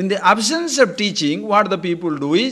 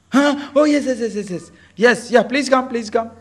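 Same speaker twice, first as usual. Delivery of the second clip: high, startled, over-eager host; gasp, then gush a repeated welcome; flustered welcome; 6 semitones higher, 3 dB louder than usual. Acted. Host told guests are coming, flustered and over-eager.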